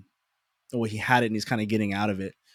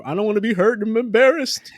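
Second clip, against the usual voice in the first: mock whiny plea; 10 semitones higher, 5 dB louder than usual.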